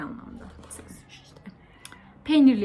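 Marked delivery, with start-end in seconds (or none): 0.0-1.4 confessional whisper. Real, not acted. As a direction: whisper it like a secret. Confessing aside. (fısıltıyla itiraf)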